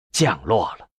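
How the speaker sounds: plain reading voice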